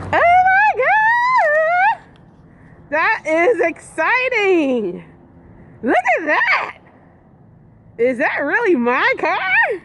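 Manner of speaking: screaming high with joy; real overjoyed loud squeals; ecstatic. Real, not acted. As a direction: shriek at the sight of it. Seeing her new car.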